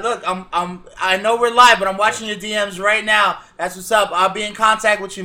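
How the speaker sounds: normal talking voice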